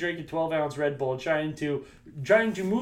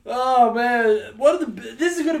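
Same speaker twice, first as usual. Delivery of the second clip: laughing; 7 semitones higher, 8 dB louder, slower than usual. Real, not acted. Laughing fit over water.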